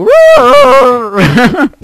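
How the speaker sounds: high growling "Rrr"; whale noise. Retold or imitated. Mocking an English accent as whale noise, high growl. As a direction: groan like a whale. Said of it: "sounds like a fucking whale".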